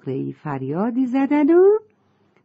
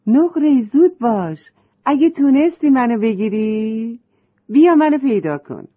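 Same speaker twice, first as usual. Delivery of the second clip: higher, louder teasing call; playful shout; 5 semitones higher, 6 dB louder than usual. A narrator's reading. Butterfly calls to the girl in play, shouting playfully. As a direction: call out loud in a game of hide and seek.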